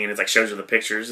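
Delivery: ordinary delivery, nothing marked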